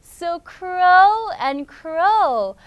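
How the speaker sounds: slow, loud storybook cheer; loud urging cry. Acted. Friend urges Rookie to crow, loud and drawn out. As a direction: draw it out loudly, urging a friend on. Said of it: "괴성을 질러보고 있네요".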